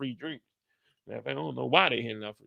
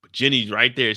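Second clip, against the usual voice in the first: usual speaking voice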